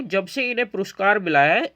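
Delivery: usual speaking voice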